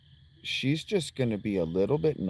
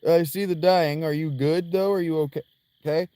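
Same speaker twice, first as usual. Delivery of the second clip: ordinary delivery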